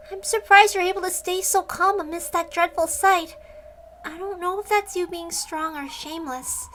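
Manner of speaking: a hint of a sly young girl. Voices a teasing companion character.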